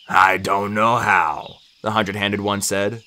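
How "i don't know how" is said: slow, low giant's voice; ponderous, deep giant; Briares. A narrator's reading. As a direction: speak slowly as a huge humbled creature. Hundred-handed giant speaks.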